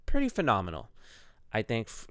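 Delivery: normal talking voice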